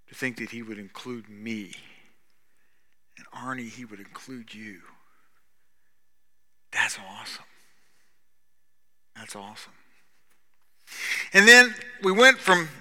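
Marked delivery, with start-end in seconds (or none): 0.1-9.7 sinking to a near whisper in wonder; hushed awe. Real, not acted. Preacher marvelling to congregation, near whisper. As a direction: let it drop to a breathy hush, marvelling. Awed, personal point to congregation.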